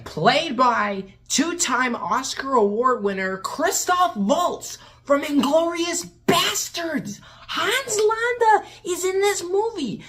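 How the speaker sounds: slow build to high, stretched exclamation; amazed announcement. Real, not acted. Reviewer revealing the villain's actor, amazed build-up. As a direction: hype each word up to disbelief.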